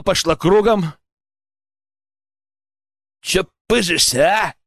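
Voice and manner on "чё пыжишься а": lightly taunting villain; mocking scoff. A narrator's reading. Poacher taunting the captive straining at his ropes, scoffing. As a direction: needle him with a scoff. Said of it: "хмыкнул Сивый".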